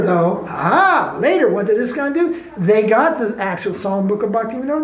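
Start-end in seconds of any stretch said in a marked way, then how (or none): none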